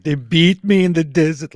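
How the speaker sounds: high, loud dramatic Irish attempt. Shown as a demonstration. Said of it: "it has to be more dramatic".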